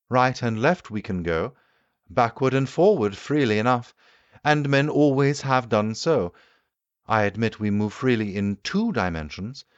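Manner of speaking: the keen inventor holding forth to his guests; the Time Traveller lecturing. Acted. Time traveller answers the doctor.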